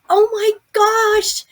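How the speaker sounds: high, loud, drawn-out amazement; astonished gasped exclamation. Retold or imitated. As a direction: cry out in stunned delight. Realizing her dead friend is there.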